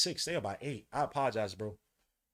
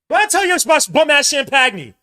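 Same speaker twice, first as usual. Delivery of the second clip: frustrated shout; 8 semitones higher, 15 dB louder than usual. Real, not acted. Fan yelling at his player.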